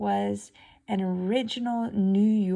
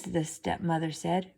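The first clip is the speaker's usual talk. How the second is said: plain reading voice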